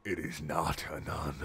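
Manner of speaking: low, soft, eerie stranger voice; strange voice. Acted. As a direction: disguise the voice, nothing like Dad. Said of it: "He says in a voice nothing like my father's".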